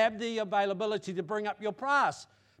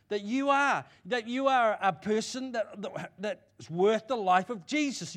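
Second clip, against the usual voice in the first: loud, raised preacher's voice; impassioned preaching; 4 semitones higher, 7 dB louder than usual. Real, not acted. Preacher telling the congregation they are valuable to God, impassioned preaching. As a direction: drive each affirmation home with force.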